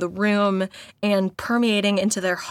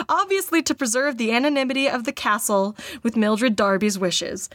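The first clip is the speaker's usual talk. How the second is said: usual speaking voice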